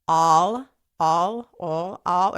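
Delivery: careful, corrected 'All', repeated to get it right; drilling 'All' with no accent; proper pronunciation. Shown as a demonstration. Correcting her own accent, saying 'All' properly. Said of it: "Can we say it properly, please?".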